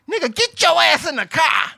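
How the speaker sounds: high, loud bossy woman's voice; girl barking an order. Retold or imitated. Girl ordering him into the car, high and loud. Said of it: "and the girl goes".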